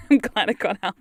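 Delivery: laughing. Real, not acted. Relieved at leaving the UK, laughing through it.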